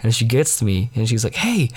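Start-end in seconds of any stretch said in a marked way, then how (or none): 1.3-1.7 high, perky hey; imitating an eager girl's high hey; eager greeting. Retells girl's eager approach.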